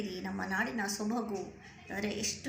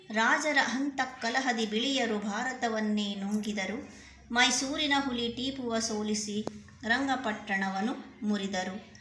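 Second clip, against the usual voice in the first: sung-out, rhythmic poem reading, a little louder; poem recitation; 3 semitones higher, 4 dB louder than usual. Acted. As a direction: recite it proudly to the class, in a steady beat. Teacher recites the full poem.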